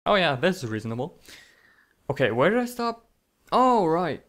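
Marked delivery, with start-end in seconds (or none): none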